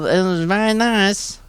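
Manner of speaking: put-on slow, lightly childish voice; a hint of a little child, drawn out; slow mock little-kid voice; child-like Jimmy. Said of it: "he sounded like that back then".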